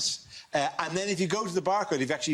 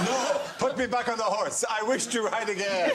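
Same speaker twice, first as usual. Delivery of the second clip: mock Putin; 5 semitones higher than usual. Voicing Putin in fake photos.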